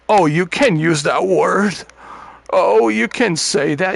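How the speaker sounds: mocking sensitive people. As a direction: sneer it like a touchy scold, slowly. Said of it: "Everybody's sensitive".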